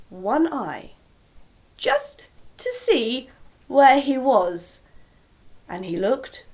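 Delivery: slow, hushed storytelling; slow suspense for the listening children; suspenseful narration. A narrator's reading. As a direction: draw out the tension slowly.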